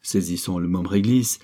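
ordinary delivery, nothing marked

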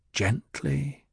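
a hushed thought meant only for herself; soft inner thought. A narrator's reading. Jessica silently urging Paul to go easy, soft inner voice. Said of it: "Jessica thought".

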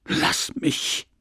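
hissed, furious, barely voiced; angry whisper. Wife pushes her husband away, hissing in fury. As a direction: spit it out in a rage under the breath. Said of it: "rot vor Wut".

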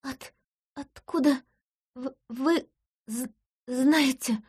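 stammering, slow and high with fear; frightened stutter. Acted. Woman caught under a false name stammers in fear. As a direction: catch on every first sound as if choking. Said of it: "Заикание лечится прекрасно".

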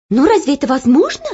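fast, high amazed question; surprised. Acted. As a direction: marvel at it. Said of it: "удивилась Оля".

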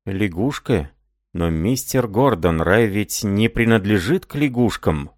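usual speaking voice

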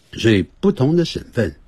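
ordinary delivery

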